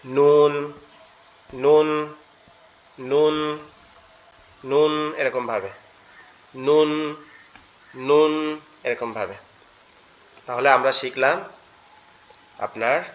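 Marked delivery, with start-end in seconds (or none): 0.0-8.6 নুন said firmly, tongue tip on the palate; Arabic letter nun; letter nun demonstrated. Shown as a demonstration. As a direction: ring out নুন clearly. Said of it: "লেগে উচ্চারিত হবে".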